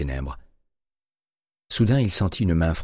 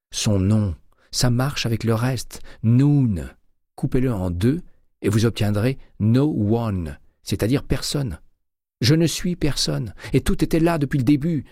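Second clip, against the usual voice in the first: much higher, louder, some words slowed; feverish revelation; 7 semitones higher, 4 dB louder than usual. A narrator's reading. Terry decoding the killer's name for Winston, feverish. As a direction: spell it out with mounting excitement.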